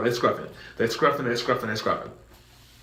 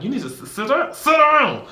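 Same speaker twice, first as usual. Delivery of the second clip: very high, louder "sit down"; managers ordering; 13 semitones higher, 6 dB louder than usual. Retold or imitated. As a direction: force him into his seat.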